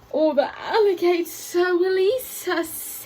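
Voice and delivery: slow, hissing snake voice; a hint of a hissing snake. A narrator's reading. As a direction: hiss it out like a wary snake. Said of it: "hissed the snake".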